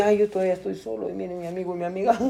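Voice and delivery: soft grumbling to oneself; envious self-pity. Acted. Preacher voices a lonely congregant envying friends, quiet self-pitying grumble. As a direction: murmur it like a private complaint.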